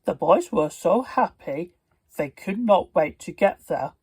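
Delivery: usual speaking voice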